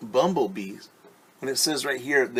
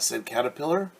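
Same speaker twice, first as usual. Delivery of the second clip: plain reading voice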